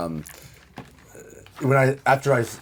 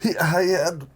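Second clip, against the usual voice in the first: high, halting wounded voice; pained, breathless; 7 semitones higher than usual. Acted. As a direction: gasp out the words in pain. Wounded character gasping out story.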